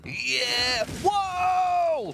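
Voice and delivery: high, whooping cheer; excited shout. Real, not acted. Caster reacting to a surprise pickup, excited shout. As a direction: let out a big high-pitched whoa.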